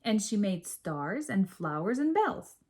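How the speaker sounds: ordinary delivery, nothing marked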